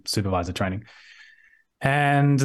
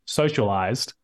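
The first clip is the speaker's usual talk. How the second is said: normal talking voice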